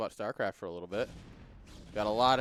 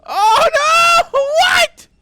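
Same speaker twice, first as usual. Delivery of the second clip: shrieked, far higher and louder, laughing; shocked scream; 20 dB louder, much slower than usual. Real, not acted. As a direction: scream in real disbelief. Disbelief at a game moment.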